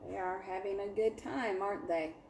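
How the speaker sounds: expressive picture-book read-aloud for a class; lively storybook narration; story reading to children. A narrator's reading. Teacher reads picture book aloud.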